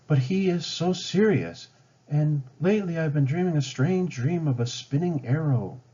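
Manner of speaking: a hint of a worried young woman confiding; lightly voiced young Pocahontas. Pocahontas telling her dream.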